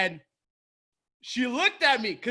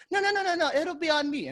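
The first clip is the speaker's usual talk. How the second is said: high, rapid string of refusals; imitated insistent mom; 8 semitones higher than usual. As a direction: wave the offer away in a high voice. Mom waving off offer to pay.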